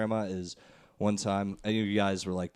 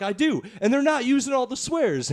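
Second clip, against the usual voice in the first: put-on higher, insistent kid arguing; heated kid; 13 semitones higher, 5 dB louder than usual. Grandson insisting to grandma, getting louder. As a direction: push back like a stubborn ten-year-old.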